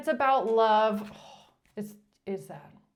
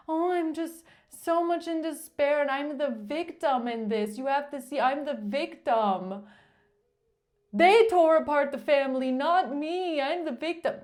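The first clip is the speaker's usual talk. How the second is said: a hammed-up whiny plea, pitched higher; mock whiny victim; 4 semitones higher, 3 dB louder than usual. Reader mocking the ex's plea to the judge, hammed-up whine. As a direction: whine it like a fake victim begging.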